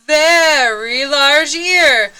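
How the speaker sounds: slow, loud build-up; mounting drama; building suspense. A narrator's reading. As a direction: stretch it before the sneeze. Build-up to the sneeze.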